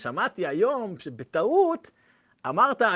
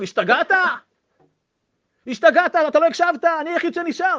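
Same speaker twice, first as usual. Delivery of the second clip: loud, much higher yelling; shouting; 12 semitones higher, 10 dB louder than usual. Retold or imitated. Survivor yells at the rabbi, furious and loud. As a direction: yell at him in disbelief. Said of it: "התחיל לצעוק עליו".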